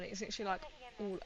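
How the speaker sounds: ordinary delivery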